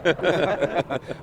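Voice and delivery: laughing. Real, not acted. Host cracks up at guest.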